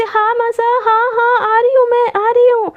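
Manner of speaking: high, loud hurried answer across the house; calling back. Acted. Shouting back to calling mother. (ज़ोर से चिल्लाकर जवाब)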